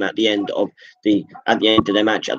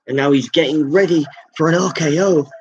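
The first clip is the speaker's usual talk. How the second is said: ordinary talk, nothing marked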